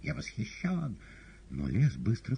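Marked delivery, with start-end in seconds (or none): 0.0-1.0 very high mimicked bird cry; screeching parrots. A narrator's reading. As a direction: squawk it twice like an admiring parrot. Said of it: "оглушительно кричали".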